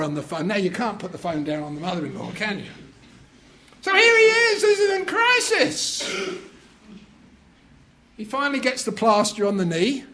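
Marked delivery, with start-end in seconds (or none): 3.8-6.1 high, loud breathless narration; mounting panic. A narrator's reading. Preacher narrating the husband's crisis, high and loud. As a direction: tell it like everything's falling apart.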